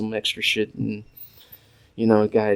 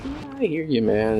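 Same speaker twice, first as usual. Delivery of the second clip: half-hearted high agreement, falling away; humoring; 8 semitones higher than usual. Retold or imitated. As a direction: drag it out high, humoring him. Humoring older coworker's talk.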